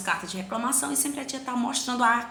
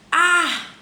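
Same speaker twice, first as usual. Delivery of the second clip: ar said high and loud; teacher sounding out ar; stressed sound; 7 semitones higher, 6 dB louder than usual. Shown as a demonstration. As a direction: call out ar clearly as a sample. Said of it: "Já dá pra perceber que é ar".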